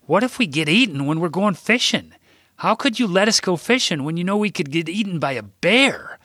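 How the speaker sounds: put-on high, worried child voice; a scared boy pleading with grandpa; child asking in alarm; frightened little boy.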